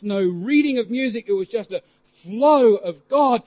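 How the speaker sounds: normal talking voice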